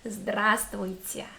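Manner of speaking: Здравствуйте with a stretched, long "a" in the first part. Shown as a demonstration. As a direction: draw out the "a" of Здравствуйте slowly. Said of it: "do you hear this long sound ah".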